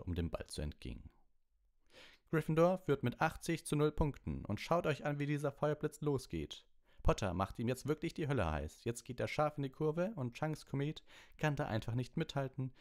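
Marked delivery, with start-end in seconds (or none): 2.3-12.7 high, rushing play-by-play; schoolboy commentator hyping the Firebolt; eager commentator. A narrator's reading. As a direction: gush about the new broom. Commentator calls the match.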